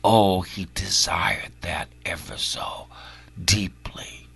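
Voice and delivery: hushed whisper; breathy whispered sorrow. Real, not acted. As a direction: let it sink to a whisper. Whispered warning in sermon.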